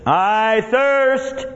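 high, drawn-out call; crying out. Acted. Jesus on the cross fulfilling the scripture, crying out. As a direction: call it out high, holding the word.

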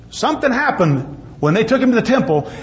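ordinary talk, nothing marked

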